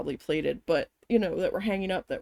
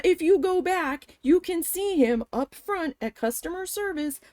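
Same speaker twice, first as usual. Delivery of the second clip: higher, emphatic pleading of the case; indignant insistence; 5 semitones higher than usual. Retold or imitated. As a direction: argue it hard, pitch raised. Acted argument with manager.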